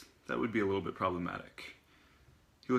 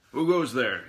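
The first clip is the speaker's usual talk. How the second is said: loud, raised challenge to an intruder; growled challenge; 4 semitones higher, 12 dB louder, slower than usual. A narrator's reading. Otto challenging footsteps on the basement stairs, growled challenge. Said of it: "he growled".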